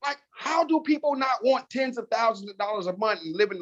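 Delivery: retold exasperated wonder; incredulous. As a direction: plead in baffled amazement, pitched well up. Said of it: "I kept saying to Tiffany DeBerry".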